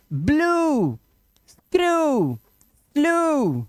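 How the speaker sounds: ब्लू, स्क्रू, स्लू with long, high "oo" endings; word-by-word demo, each ऊ drawn out high. Shown as a demonstration. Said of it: "ईडब्ल्यू का जो उच्चारण है वो ऊ".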